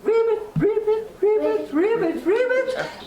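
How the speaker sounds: high ribbit croaking; frog croak. Acted. Frog croaks while leading rain.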